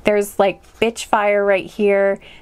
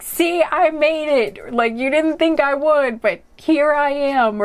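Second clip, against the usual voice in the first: higher-pitched, triumphant cry at ordinary volume; mock victorious shout; 6 semitones higher than usual. Reader voicing the listener's triumph to the world, mock victorious shout. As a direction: proclaim it like a half-shouted victory. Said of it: "kinda shouting it out to the world".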